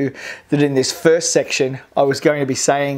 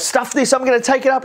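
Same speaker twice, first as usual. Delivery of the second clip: defiant fan; 9 semitones higher, faster than usual. Retold or imitated. Fan restarting the wave.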